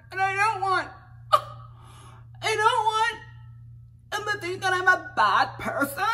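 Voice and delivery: high, far-off voice faking tearful regret; mock tearful confession. Mocking a cast member's tearful confession, high and whiny. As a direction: whimper it like a crocodile-tears confession.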